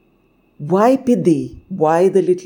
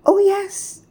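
high, squeaky little bird reply; tiny bird. A narrator's reading. Bird answers the sun, high and squeaky. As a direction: pipe up in agreement. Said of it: "I reply".

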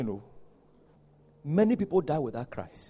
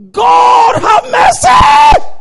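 very high, very loud wail for mercy; drawn-out shout; 17 dB louder, much slower than usual. Retold or imitated. Dying atheist crying to God before the doctors, shouted. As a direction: scream it out slowly with full force. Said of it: "the man shouted in the hearing of the doctors".

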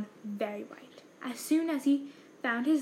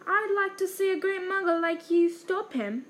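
Hagrid's voice; 7 semitones higher, 5 dB louder than usual. A narrator's reading.

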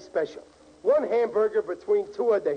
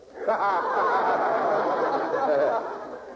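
laughing at own joke. Real, not acted.

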